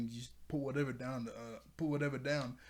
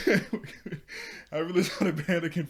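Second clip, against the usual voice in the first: a loud laughing "We're good", pitched up; laughing, louder; 4 semitones higher, 10 dB louder than usual. Real, not acted. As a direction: laugh it out with relief.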